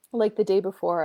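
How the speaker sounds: normal talking voice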